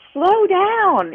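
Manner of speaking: high, loud playful call. Retold or imitated. Telling the recovered friend to slow down, high playful call. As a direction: call after her to slow down. Calling to fast-walking friend.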